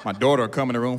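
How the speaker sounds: ordinary delivery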